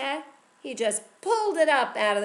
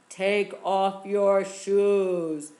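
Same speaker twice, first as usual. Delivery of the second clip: powerful voice; 7 dB louder, much slower than usual. A narrator's reading. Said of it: "it sounded like a powerful voice".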